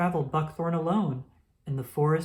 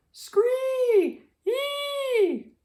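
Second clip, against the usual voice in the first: very high, shrill squealing rabbit cries; rabbit's squeal for help; 4 dB louder than usual. A narrator's reading. Trapped rabbit cries for help, very high squealing shrieks.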